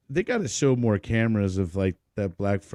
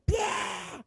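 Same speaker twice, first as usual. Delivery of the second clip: very high blurted "bah"; imitated laugh burst; 5 dB quieter than usual. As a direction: mimic a held-in laugh exploding. Retold burst of uncontrolled laughter.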